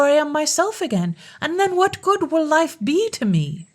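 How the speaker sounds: high, lamenting bird-king; bewailing. Acted. Bird-king in the wood mourning his princess, high lament. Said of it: "he bewailed his miserable fate".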